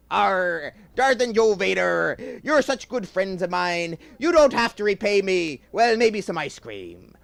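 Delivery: high, loud hearty pirate growl. Acted. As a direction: ham up the old pirate. Pirate speaks again.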